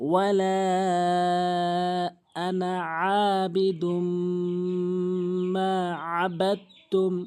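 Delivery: slow tajweed with long held vowels; Quranic recitation. Shown as a demonstration. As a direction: chant the verse slowly, stretching each madd. Teacher recites next verse as model.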